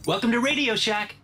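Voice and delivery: high, perky customer-service voice; store clerk greeting. Acted. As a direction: greet a customer with scripted eagerness.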